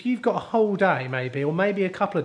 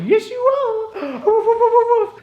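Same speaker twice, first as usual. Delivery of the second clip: excited dog talk; 6 dB louder than usual. Real, not acted. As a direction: squeal playfully at a dog, then woof along. Playing with the customer's dog.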